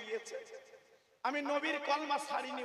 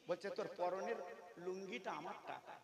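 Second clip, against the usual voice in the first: usual speaking voice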